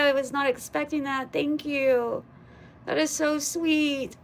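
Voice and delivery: high, loud burst settling into moved thanks; thrilled and touched, nearly crying; real surprised gratitude, pitched high; overcome with gratitude. Real, not acted. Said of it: "I'm gonna cry".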